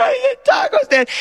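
very high crying whine; mock sobbing. Disappointed nine-year-old at the taco stand, mock sobbing. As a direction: sob it out like a crushed kid. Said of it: "He just goes".

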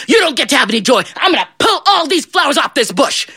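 high, heated outburst; angry threat. Acted. Devil denies John his flowers, angry threat.

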